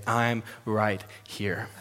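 slow, soft and low; tender reassurance. Acted. Parent comforting a child after nightmares, slow and soft. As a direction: hold the child and soothe him slowly.

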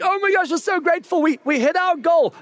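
high, gushing "we're so grateful" squeal; mock gushing excitement. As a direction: play an overexcited ministry team, pitch way up. Church celebrating a fundraising goal.

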